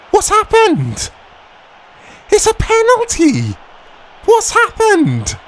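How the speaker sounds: high, loud, disbelieving cry; shocked shout. Real, not acted. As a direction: yelp in shock at the penalty. Gamer shocked by penalty call.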